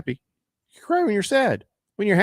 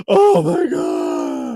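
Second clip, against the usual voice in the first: high, stretched-out squeal of joy; drawn-out hammed-up shriek, much higher and louder; mock overjoyed shout; 14 semitones higher, 4 dB louder, much slower than usual. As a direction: ham up a player sobbing with happiness over his horse. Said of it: "He's like".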